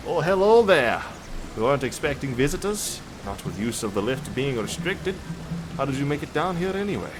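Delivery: put-on half-successful Irish or Scottish character accent; attempted Irish or Scottish accent. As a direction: try an Irish or Scottish lilt and miss. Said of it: "supposed to do Irish or Scottish there".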